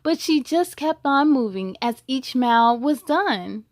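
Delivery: rhyming storybook read with a steady lilt; picture-book narration; reading to children. A narrator's reading. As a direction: read it to a class of kids. Reads a picture book aloud.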